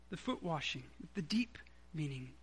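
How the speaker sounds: hushed whisper of the key point; a reverent whispered aside. Real, not acted. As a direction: lean in and whisper it. Sermon drops to hushed aside.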